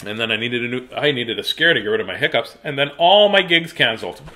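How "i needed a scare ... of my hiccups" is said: ordinary delivery, nothing marked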